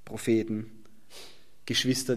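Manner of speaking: ordinary talk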